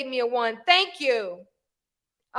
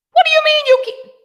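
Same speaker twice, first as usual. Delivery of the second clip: loud, incredulous challenge rising higher; indignant disbelief; 12 semitones higher, 10 dB louder than usual. Acted. Challenging a grandmother who won't take the phone, indignant. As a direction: burst out louder and higher in disbelief.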